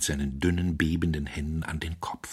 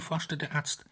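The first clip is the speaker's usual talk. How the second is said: ordinary delivery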